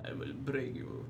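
menacing Drago impression. Echoing Drago quote, lower.

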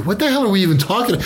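ordinary talk

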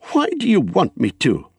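sharp, high challenge; suspicious, annoyed. A narrator's reading. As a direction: demand it with sudden distrust. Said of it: "sharp with suspicion and annoyance".

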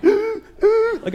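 gasping and heaving, words stretched out; out of breath. Retold or imitated. Winded passenger talking to the stewardess, gasping. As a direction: fight for air after the sprint.

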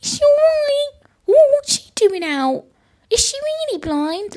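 very high-pitched mock-concerned gossip; exaggerated busybody falsetto; gawping strangers. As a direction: mimic nosy onlookers in a high, drawn-out squeal. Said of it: "people were still just looking at me like".

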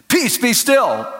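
high, firm rebuke; Peace, be still cried out with authority; ringing command. Retold or imitated. Preacher rebuking the hail storm, commanding. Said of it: "I was trying to remember".

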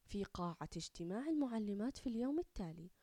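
ordinary delivery, nothing marked

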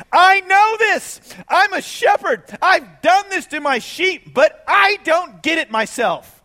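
high, loud pleading David; voicing David. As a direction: cry out as bewildered David. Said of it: "And David's saying".

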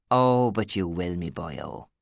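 knowing Irish tease; Irish teasing. A narrator's reading. Alice teasing boss Steve, knowing Irish lilt. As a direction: needle him in a brogue.

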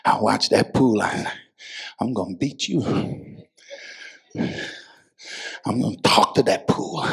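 low, soft aside after the climax; quiet, meditative preaching. Real, not acted. Preacher recalling how they study the pool, quiet and meditative. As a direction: drop right down and brood on it.

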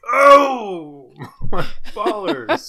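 high, strained falsetto cry; seventies guy squeezing into pants. Retold or imitated. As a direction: squeal it like squeezing into tight pants. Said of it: "as they slipped them on".

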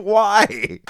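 a high, incredulous mock "why"; bemused imitation of the listener; teasing mock confusion; mock puzzled. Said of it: "It was like".

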